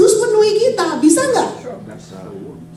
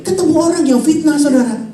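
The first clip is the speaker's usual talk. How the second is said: ordinary delivery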